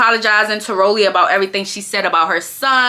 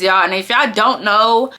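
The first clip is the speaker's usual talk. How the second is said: ordinary delivery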